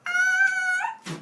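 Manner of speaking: very high, loud drawn-out shriek; hammed-up death scream; mock scream. Human turns to dust before Zeus, mock shrieking. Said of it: "you would just go".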